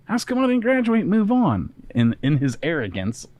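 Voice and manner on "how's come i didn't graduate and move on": whiny, much higher voice of a spoiled student; a complaint pitched way up in disbelief; arrogant student. Retold or imitated. Storyteller voicing the spoiled student's complaint, high and whiny. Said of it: "he thought to himself".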